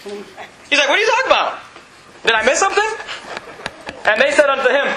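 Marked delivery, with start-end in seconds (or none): 0.9-2.9 high-pitched, puzzled questions; mock-baffled, pitch jumping up; playing clueless. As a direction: act innocently out of the loop. Acts out Jesus playing unaware.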